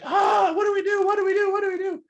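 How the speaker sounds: high, loud panicked shouting; crowd panic. Retold or imitated. Whole audience panicking at the drunk speaker, shouted mock panic. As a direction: yell it like the whole room is losing it. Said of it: "everybody's like".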